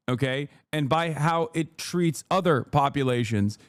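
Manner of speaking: ordinary talk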